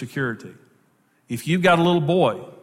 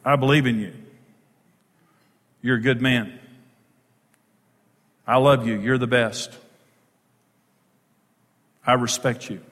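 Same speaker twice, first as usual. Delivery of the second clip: low, tender words with long pauses; respectful affirmation; 6 semitones lower than usual. Acted. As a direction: affirm him sincerely, low and unhurried. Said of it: "say it in the right way".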